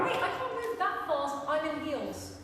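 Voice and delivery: high, loud frightened plea; panicked plea. Acted. Election observer begging the police as they drag her, panicked high cry. Observer being dragged away.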